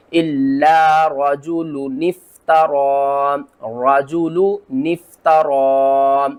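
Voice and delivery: slow chant, both lam and noon sounded in "रजुलुन इफ्तरा"; tajweed recitation. Shown as a demonstration. Teacher shows correct reading after common mistake, slow chant. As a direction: draw it out slowly, keep lam and noon. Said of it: "दोनों को किस तरह पढ़ेंगे?".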